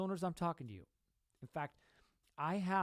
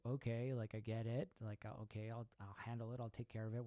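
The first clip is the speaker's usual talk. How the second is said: softer, easygoing okay; mild mock calm reply; 4 semitones lower, 7 dB quieter than usual. Husband calmly answering his wife's requests, softly. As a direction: shrug it off quietly. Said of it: "Where regularly you would say".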